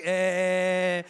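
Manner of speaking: loud, long evasive eh; stalling politician's eh. Retold or imitated. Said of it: "It's always like".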